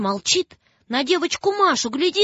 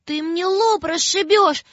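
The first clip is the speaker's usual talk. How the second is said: put-on higher, teary pleading girl; tearful plea; 7 semitones higher than usual. Маша плачет и просит зверя не бить, higher teary plea. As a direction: beg through tears. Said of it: "опять заплакала и говорит".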